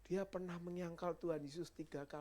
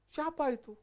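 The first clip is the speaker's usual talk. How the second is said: high dismissive cry; 11 semitones higher, 9 dB louder than usual. Acted. Peter feigning ignorance.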